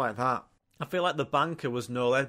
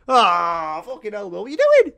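much higher, louder outraged yell; mock outraged crowd; 8 semitones higher, 10 dB louder than usual. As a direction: play the fuming audience shouting at the host. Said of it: "the whole audience would be like".

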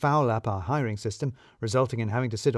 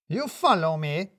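higher, slow and accusing; accusing Radu; 5 semitones higher, slower than usual. A narrator's reading. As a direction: throw the blame back at him. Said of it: "said Radu".

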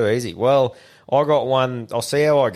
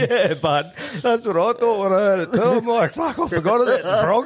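high laughing through the words; real laughter, pitch way up; 10 semitones higher than usual. Real, not acted. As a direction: chuckle while you explain. Laughing at his own joke.

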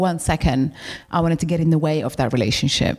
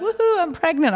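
mock cheer; 11 semitones higher than usual. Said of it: "you're like".

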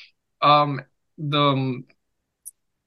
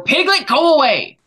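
high, loud shooing shout; calling out sharply; 11 semitones higher, 6 dB louder than usual. Real, not acted. Host to Piglet interrupting the story, sharp shout. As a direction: shout it up high to chase off a pet.

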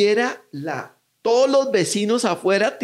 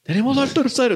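cop reporting; 9 semitones higher than usual. Retold or imitated. Repeats police call.

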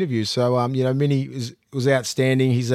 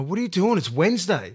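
higher, imitating the tipsters' disbelief; mock exasperation; 5 semitones higher than usual. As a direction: play the scandalised punter. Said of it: "they're fucking filthy at me".

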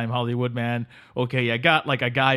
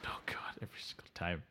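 exasperated whisper; weary whispered complaint; whispered aside; 7 semitones lower, 20 dB quieter than usual. Real, not acted. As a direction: mutter it under your breath, fed up. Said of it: "annoyed aside".

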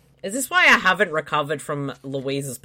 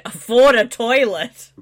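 loud; 6 semitones higher, 8 dB louder than usual. Real, not acted. Punchline shouted.

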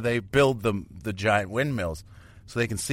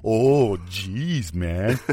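son mocking dad; 3 semitones lower, much slower than usual. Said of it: "fire me up".

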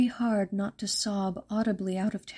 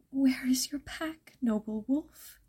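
plain reading voice

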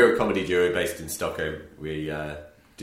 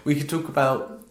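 excited; 6 semitones higher, 5 dB louder than usual. Real, not acted. Listing random things excitedly.

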